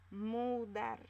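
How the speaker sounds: Mudar said as a clear example word; teacher's example word. Shown as a demonstration. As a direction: present Mudar to the class. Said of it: "Separamos en sílabas". (palabra de ejemplo Mudar, clara)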